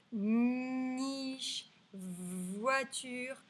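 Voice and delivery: NICHE and VOITURE with long n and v; elongated first sounds. Shown as a demonstration. Teacher repeats the list, "niche, voiture" drawn out. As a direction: stretch the starts of "niche, voiture". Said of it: "je répète".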